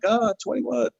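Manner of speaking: mock-innocent, pitched well up; innocent answer. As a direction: say it like nothing's unusual about it. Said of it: "And I'm like".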